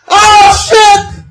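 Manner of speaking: loud panicked shout; real scream of fright; terrified shout. Real, not acted. Screaming at VR horror jump scare.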